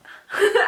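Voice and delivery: a sudden high laugh through tears; high burst of laughter. Real, not acted. To her viewers, laughing through tears. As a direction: laugh it off, bright and quick.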